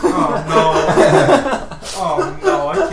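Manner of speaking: laughing hard through the words; cracking up and can barely get it out; giggling helplessly, loud and high. Real, not acted. Laughing at cohost's joke.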